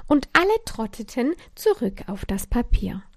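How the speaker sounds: usual speaking voice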